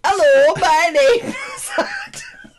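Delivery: loud, high, slow mock northern accent; Adam's northern accent. Introducing herself as northern co-host, mock northern accent. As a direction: ham up the north, breaking into laughter. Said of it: "'Cause he's from up north".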